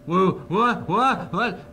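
high, loud startled whoa; mock alarm. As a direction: jump in alarm, hammed-up. Mock shock at changing keys.

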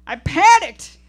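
high, loud giggling shriek; scream mid-giggle. Acted. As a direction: scream it while half laughing. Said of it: "she screams mid-giggle".

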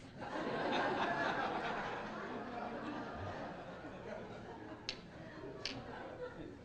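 high, breathy burst of laughter; real laugh. Real, not acted. Speaker laughing at own golf joke with the audience.

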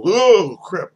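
sudden loud "oh crap" jolt; startled outburst. Retold or imitated. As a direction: yelp it out, caught off guard. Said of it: "guess it'll make me go".

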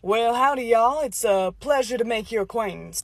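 Texas cowboy charm; Texan accent. Acted. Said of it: "where that exotic accent is from".

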